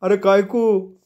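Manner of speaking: high-pitched wary question; high, suspicious boy's voice; nervous, suspicious. A narrator's reading. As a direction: question him fearfully in a high voice. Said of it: "घाबरी संशयी नजर".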